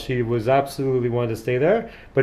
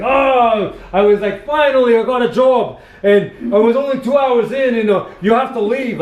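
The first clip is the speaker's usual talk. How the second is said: loud, high-pitched Aussie wail, imitated; hammed-up Aussie accent, far higher and louder; strong Aussie accent; 12 semitones higher, 9 dB louder than usual. Backpacker telling how she lost her job, imitated strong Aussie accent. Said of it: "her strong Aussie accent".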